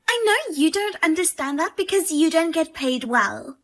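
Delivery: normal talking voice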